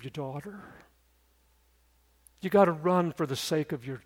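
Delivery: quiet, grave altar-call delivery; solemn preacher's appeal. Real, not acted. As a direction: plead earnestly, phrase by phrase.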